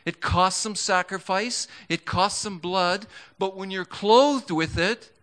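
high, driving sermon delivery; fervent preacher building to a climax; impassioned preaching. Real, not acted. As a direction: preach it with mounting urgency, pitched well up. Preaches with rising fervor.

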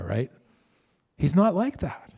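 ordinary talk, nothing marked

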